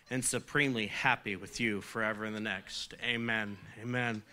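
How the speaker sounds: group prayer. Real, not acted. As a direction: lead the room through the prayer.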